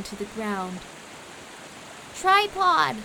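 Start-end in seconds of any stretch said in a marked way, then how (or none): 2.1-3.0 high, loud screech of a name; urgent high-pitched yell; screeched call. A narrator's reading. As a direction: screech it out to someone in danger. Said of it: "Quincy screeched".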